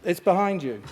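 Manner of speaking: high, loud pantomime call; children's theatre actor. Pretend. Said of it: "Sounds like a pantomime".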